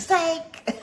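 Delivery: snatch the hand away laughing; laughing psych. Retold or imitated. Eighties friend pulling the prank, laughing. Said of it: "people would be like".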